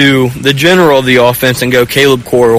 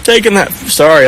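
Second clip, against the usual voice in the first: real choked-up break, starting higher; 7 semitones higher than usual. Real, not acted. Player recalling his late dad's request, choked up. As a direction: fight back tears after a long halt. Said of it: "I'm getting choked up".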